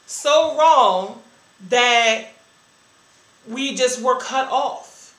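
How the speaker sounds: retold hurt, rising and loud; anguished outcry. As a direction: cry it out like you were abandoned. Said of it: "And I'm like".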